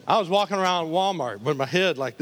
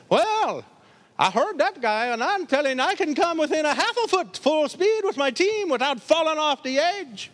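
a hint of a cocky driver, higher; boastful driver; 11 semitones higher, 4 dB louder than usual. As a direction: outbrag the first guy. Second driver boasting louder.

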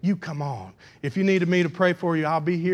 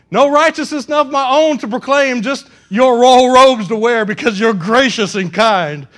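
loud, raised pulpit declaiming; impassioned declaration; 7 semitones higher, 10 dB louder than usual. Acted. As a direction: proclaim it to God with conviction. Declaims confession at full force.

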